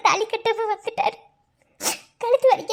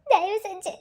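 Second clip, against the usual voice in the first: tearful plea. Acted. Heroine begs uncle, crying.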